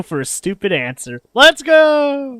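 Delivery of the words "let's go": excited shout. Real, not acted. Streamer hyping his dialogue choice.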